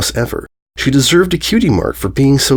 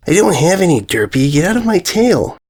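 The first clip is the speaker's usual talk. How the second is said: higher, irritated filly brushing her off; higher, irritated young filly snapping at a pest; annoyed filly; 7 semitones higher than usual. Acted. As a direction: shoo the pest away. Filly snaps at Derpy.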